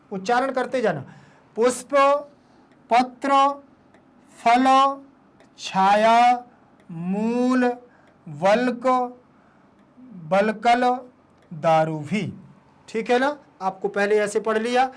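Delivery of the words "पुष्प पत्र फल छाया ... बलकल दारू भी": each word alone, long pauses between. Shown as a demonstration. Teacher models a hard line for pupils, word by word. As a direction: read it out one separate word at a time. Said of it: "पहले एक एक शब्द को".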